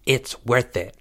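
soft, stubborn insistence; hushed defiance; whispered. A narrator's reading. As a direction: insist under your breath. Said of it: "he whispered".

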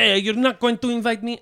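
high, fast mock French accent; a hammed-up French accent. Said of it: "but with a French accent".

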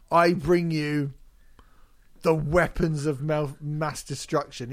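long pause after "I bring you"; "I bring you" drawn out, then a big gap before "the weapons"; massive dramatic gap. Retold or imitated. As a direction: leave "I bring you" hanging before the title. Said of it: "It's a massive gap".

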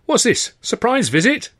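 high, delighted surprise; surprised. A narrator's reading. Landlady surprised by Freddy at her door. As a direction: act pleased and surprised.

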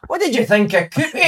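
imitating a teammate mimicking the Fraserburgh accent; mock Fraserburgh accent. Injured teammate winding up the assistant on the bus, mock Fraserburgh accent. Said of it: "[name] does the accent".

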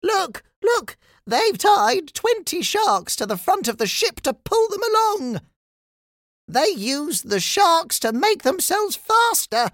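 very high, shocked outcry; Steve. Acted. Steve exposes the shark trick to everyone, shocked high cry. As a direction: cry out the discovery in a squeaky-high voice. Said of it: "cried Steve in shock".

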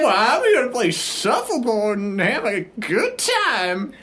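put-on high, quavery old lady; elderly wife, squeaky and mannered; Sherry Ovaltine. Old lady insisting to the bellhop, high granny voice. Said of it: "Should I do a voice?".

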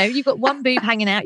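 ordinary delivery

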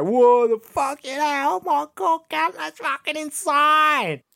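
slow, high mock Australian exclaiming; Australian accent. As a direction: gasp it out like a stunned Aussie mate. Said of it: "An Australian accent".

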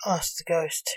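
ordinary delivery, nothing marked

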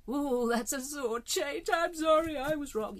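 high, loud, squeaky sorry, slowing on the ooh; a hammed-up "I was wrong"; mock pained apology. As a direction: wince through an overdone apology.

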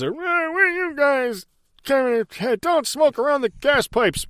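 put-on high, slow, drawn-out old codger; old drunk gas station guy. The drunk attendant warning them about smoking, slow high old man voice. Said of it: "guy with the newspaper on his face".

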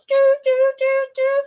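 very high, loud doo doo tones; imitated beeping. Imitating the computer tracker beeping, high sung tones. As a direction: mimic the tracker's electronic beeps.

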